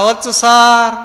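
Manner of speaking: loud, high schoolkids' chorus reply; children answering in chorus. Acted. Pupils answering the inspector together, loud and high. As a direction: shout it back like a whole class.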